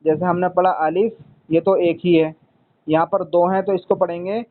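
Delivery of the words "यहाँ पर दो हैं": usual speaking voice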